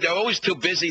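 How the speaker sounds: ordinary talk, nothing marked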